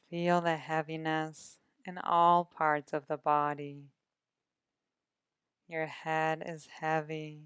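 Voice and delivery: calm, measured meditation voice with long pauses; guided meditation. Acted. Meditation guide settles listeners into body and breath, calm guided meditation. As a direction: ease the listener into rest, unhurried, drawing out each breath cue.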